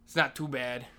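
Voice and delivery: usual speaking voice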